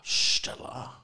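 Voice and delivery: hushed Scottish accent with a slushy sh on Stella; breathy Scottish Stella with a sh sound; Scottish accent, whispered and slurred; Sean Connery. Shown as a demonstration. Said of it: "It's not bad, right?".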